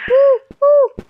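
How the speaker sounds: loud high whoops; cheering whoops. Real, not acted. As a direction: whoop it out happily. Cheering in game.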